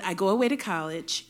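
ordinary talk

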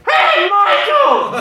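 long, high holler; calling out. Retold or imitated. Haunt actor on a chair calls down the line, drawn-out shout. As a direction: holler slowly over a whole crowd.

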